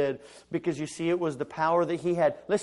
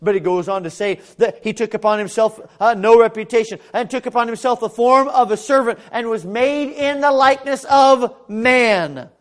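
loud, high scripture declaration; full-force preaching; 6 semitones higher, 11 dB louder than usual. Acted. Preacher on Christ becoming a servant, full-force preaching.

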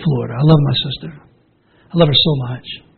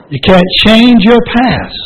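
change stressed, slow and louder; slow emphatic; 7 semitones higher, 5 dB louder, slower than usual. Shown as a demonstration. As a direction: lean hard on "change" and stretch it. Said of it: "repeated for emphasis".

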